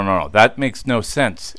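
usual speaking voice

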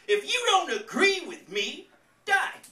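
put-on high, loud Southern accent; mock Southern accent. Comic rewriting the bumper sticker, loud Southern accent. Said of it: "in a thick Southern accent".

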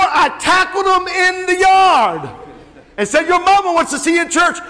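high, loud story climax; triumphant retold shout. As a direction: holler it like the chasing preacher.

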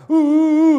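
sudden very high, very loud grunts; alarmed caveman cry. Shown as a demonstration. Teacher acts out a caveman warning of a beast. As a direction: shout a warning about a beast outside. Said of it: "Si había una fiera allá afuera".

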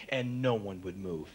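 normal talking voice

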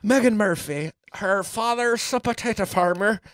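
high-pitched comic Irish lilt; put-on Irish accent. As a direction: sketch her in a hammed-up Irish accent. Plays on an Irish surname.

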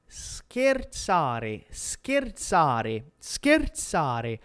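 "scherzare" drawn out slowly, sound by sound; pronunciation model. Shown as a demonstration. Teacher shows learners how the word is said, slow careful "scherzare". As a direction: stretch out each syllable of "scherzare" for learners. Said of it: "questa parola si dice in italiano, attenzione".

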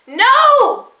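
high, loud cry of protest. A narrator's reading. Boy as his father is dragged away, high loud cry. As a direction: shout it out in desperation. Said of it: "Joseph cried".

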